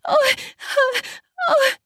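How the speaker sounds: high breathy cries; short, high cries of "oh"; crying out. Acted. Wife reacting to each smack, crying out. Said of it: "as I cried out".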